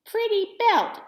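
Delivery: high, pleased troll voices; high troll voices, loud on the belt; grinning trolls. A narrator's reading. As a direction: compliment her like shy grinning trolls. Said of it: "grinning at me".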